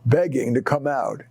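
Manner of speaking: plain reading voice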